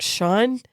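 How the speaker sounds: "Sean?" rising at the end as a question; questioning call. Retold or imitated. Climber checking on fallen friend, "Sean?" as a rising question. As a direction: call "Sean?" tentatively, pitch lifting into a question. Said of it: "with a question mark".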